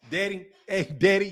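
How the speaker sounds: "daddy" pronounced "deddy"; "daddy" rhyming with "ready"; Illinois pronunciation. Shown as a demonstration. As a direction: say "daddy" with eh in the first syllable. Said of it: "Yeah, I did say daddy".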